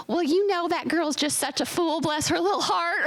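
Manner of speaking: high sugary Southern lady voice; imitating a sweet-talking Southern neighbor; Southern drawl. Southern neighbors gossiping sweetly, mock Southern lady voice. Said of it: "people would be like".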